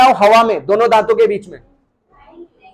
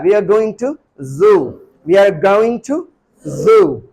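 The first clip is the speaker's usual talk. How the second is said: we are going to zoo said slowly, word by word; slow model sentence; much slower than usual. Shown as a demonstration. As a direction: spell it out slowly for learners. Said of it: "हम उसको क्या बोलेंगे?". (धीरे-धीरे साफ बोलकर)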